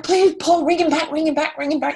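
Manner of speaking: high, loud pleading. Retold or imitated. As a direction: beg him like a desperate teen fan. Begging Paul to call again.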